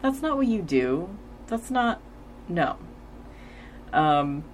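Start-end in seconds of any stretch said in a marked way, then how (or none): 0.0-2.8 confused. Retold or imitated. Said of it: "Spike is kinda confused. He's like".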